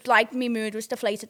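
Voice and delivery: ordinary talk, nothing marked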